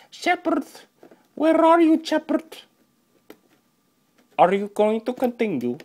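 very high silly character voice; high-pitched pretend summons; put-on character voice. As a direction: call out in a squeaky mock voice. Puts on a game-like character voice.

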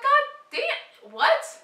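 high, loud retold shock; shocked reaction. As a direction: exclaim in loud mock disbelief. Acting out shock at the price.

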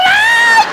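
very high, drawn-out comic scream; shrieked pain cry. Retold or imitated. Client ordered to open like a flower, shrieked pain cry. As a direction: let out a long, hammed-up shriek.

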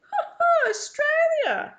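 high, loud laughing exclamation; impressed driver. A narrator's reading. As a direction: draw it out, loud and hugely impressed. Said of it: "The driver looks impressed".

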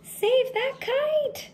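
high, slow urgent shout. Acted. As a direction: call out desperately to save it. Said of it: "shouted Mole".

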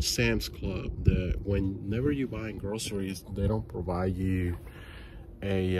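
ordinary delivery

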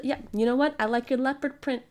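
ordinary talk

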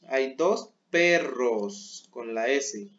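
Hay dos perros slowed, the S of perros marked; slow plural stress. Shown as a demonstration. Teacher stressing the plural S for pupils. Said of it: "vamos a hablar en plural".

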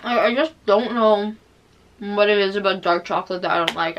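speaking between bites; talking while chewing. Real, not acted. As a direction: mouth partly full of food. Seen 2: Bites bark before and at 0.6s; cheeks and jaw working while talking.

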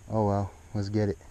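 usual speaking voice

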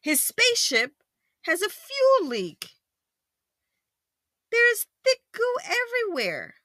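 high, deliberate storybook narration; dramatic story read-aloud. A narrator's reading. Parent reading about fuel leak, exaggerated dismay. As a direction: swoop up high, then fall at the end.

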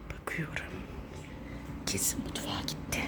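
quick conspiratorial whisper; playful whisper. Pretend. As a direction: whisper to yourself as if hiding. Whisper aside while seeking.

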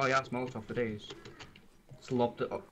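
ordinary talk, nothing marked

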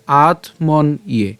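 "আত্মন ইয়ে" very slow, in separate pieces; broken into syllables. Shown as a demonstration. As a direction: part "আত", "মন" and "ইয়ে" with small gaps. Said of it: "আমরা উচ্চারণটা সুবিধার জন্য". ("আত্মন ইয়ে" ধীরে ভেঙে ভেঙে)

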